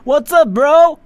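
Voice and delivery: loud, high, over-the-top hello; hammed-up, high-pitched shout-out; mock-hyped greeting. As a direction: greet the spam bot with exaggerated hype. Mock greeting to spam bot.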